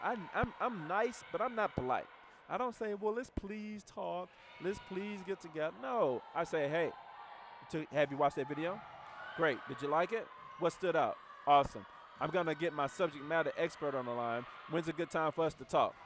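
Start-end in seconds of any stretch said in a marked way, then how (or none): 2.9-5.7 mock pleading. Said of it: "That's goofy". 6.7-15.9 louder, brisk, self-assured; just tell them, no asking; confident direct tone. Shown as a demonstration. Coach demonstrating a confident invite to a prospect, loud and sure. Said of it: "That is my tone".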